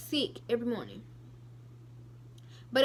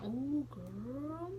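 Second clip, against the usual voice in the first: inner voice; 6 dB quieter than usual. Retold or imitated. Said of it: "that little voice in the back of my head saying".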